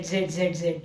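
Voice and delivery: low, soft drowsy zzz; sleepy Z sound. Shown as a demonstration. Teacher showing kids the sleepy sound, soft and low. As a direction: drift off like a sleeper snoring. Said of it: "जब सोने का expression बताते तब Z".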